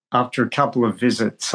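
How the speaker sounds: ordinary talk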